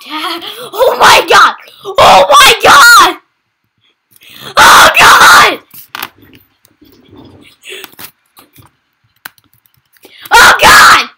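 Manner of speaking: loud, high panicked yells; frightened shouting. Real, not acted. Reacting to a jumpscare in the game, real panicked shouting.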